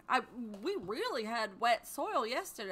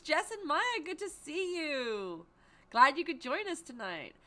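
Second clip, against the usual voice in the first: ordinary talk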